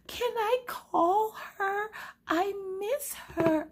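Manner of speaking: very high, slow, whiny mock-crying child; hammed-up weepy kid squeal; crying little daughter. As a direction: drag it out like a tearful little girl. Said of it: "who is uncontrollably crying as well".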